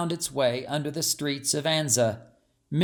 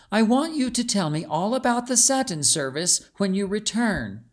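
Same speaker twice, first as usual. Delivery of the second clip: put-on higher, earnest young lady pressing her maid; urging princess; 6 semitones higher than usual. A narrator's reading. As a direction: urge her maid to report back. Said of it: "urged Beatrice".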